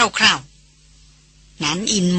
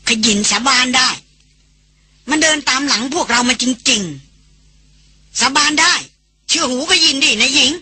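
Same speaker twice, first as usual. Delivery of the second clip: higher, pleading, swearing it's true; trembling hoarse plea; 5 semitones higher, slower than usual. A narrator's reading. Guide Khayin swears to his mistress, trembling and hoarse. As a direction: beg her to believe, shaken. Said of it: "ด้วยน้ำเสียงสั่นแหบ".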